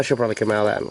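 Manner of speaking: ordinary talk, nothing marked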